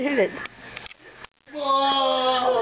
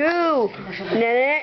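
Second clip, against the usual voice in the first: ordinary talk, nothing marked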